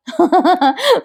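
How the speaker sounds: short, high-pitched chuckle; high little laugh of amusement; amused laugh. Real, not acted. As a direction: laugh lightly at his predicament. Narrator laughs at Arjun's embarrassment.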